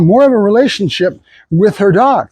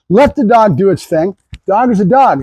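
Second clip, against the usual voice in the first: ordinary talk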